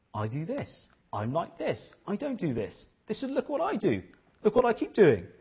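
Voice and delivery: starts hushed, climbs high and boastful; a smug braggart getting carried away; mocking Pharisee. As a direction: build from murmur to brag.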